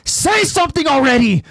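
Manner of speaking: high-pitched exasperated shout; acted impatient shouting; impatient yell. A narrator's reading. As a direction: yell out of lost patience. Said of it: "growing impatient as he yells".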